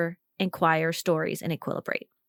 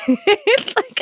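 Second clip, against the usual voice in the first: laughing, drawn out; slow laugh through words; 12 semitones higher, 6 dB louder than usual. Real, not acted. Laughing at imagined reply.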